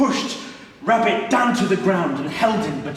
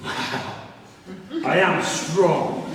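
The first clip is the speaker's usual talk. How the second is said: proud jaguar; much slower than usual. Acted.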